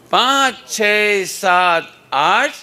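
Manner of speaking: loud slow count; slogan count. Shown as a demonstration. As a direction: call the count out to the class. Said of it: "जब मैं बोलूँगा".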